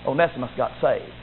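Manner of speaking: ordinary talk, nothing marked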